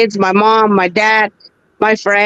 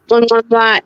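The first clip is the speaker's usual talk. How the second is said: blowing kisses. Real, not acted. Blowing kisses goodbye to group.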